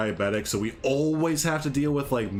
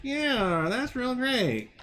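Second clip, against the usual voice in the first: mock-bright sarcastic praise, much higher; 12 semitones higher than usual. People mocking an insurance agent, high and sarcastic. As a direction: fake enthusiasm, dripping sarcasm. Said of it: "for people to go".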